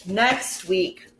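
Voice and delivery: usual speaking voice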